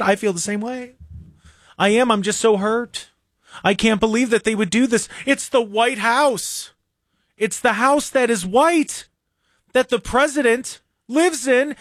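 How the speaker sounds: high, whiny mock sorrow, slowing at the end; mock Larry David. Host mocking Larry David's sad interview, high whiny hammed-up grief. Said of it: "Did you hear what Larry David said?".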